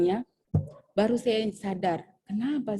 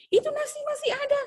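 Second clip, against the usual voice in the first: much higher, sharp senior teacher; shrill stern refusal. Retold or imitated. Senior teacher stops the new teacher cooking rice, much higher and sharp. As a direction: cut her off: the rice is still there.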